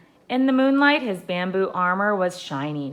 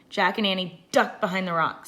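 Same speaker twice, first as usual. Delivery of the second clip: ordinary delivery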